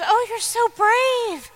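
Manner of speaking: high, slow, syrupy sympathy; mock saccharine concern; mock gushing pity. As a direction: mimic a pitying well-wisher. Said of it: "if anybody goes".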